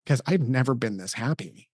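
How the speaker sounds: quietly retelling a choked-up son; a soft, tearful boy's voice, lightly voiced; son crying. Dad retells his crying son saying he is happy, softly tearful. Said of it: "And he started crying".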